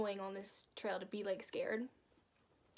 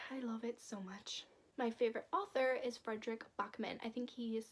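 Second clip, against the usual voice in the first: normal talking voice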